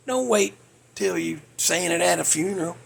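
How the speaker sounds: ordinary talk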